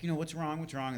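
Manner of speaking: sweet concerned brother. Retold or imitated. Little brother comforts crying sister, sweetly concerned. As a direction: soothe his upset sister. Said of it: "really sweet".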